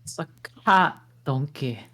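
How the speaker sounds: ordinary talk, nothing marked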